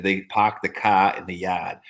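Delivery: slow, r-dropped Boston accent, "park" as pahk; lightly drawn-out Boston accent, "yard" as yahd. Shown as a demonstration. Friend showing off the Boston accent to the group, slow Boston accent. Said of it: "Boston has the thickest Boston accent".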